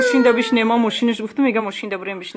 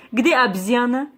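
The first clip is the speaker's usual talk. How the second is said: ordinary talk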